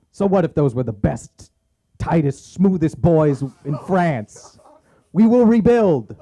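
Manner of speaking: loud, high mock-French rallying speech; rally them in a hammed-up French accent; slow, defiant French-accented proclamation. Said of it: "lore-accurate French accent".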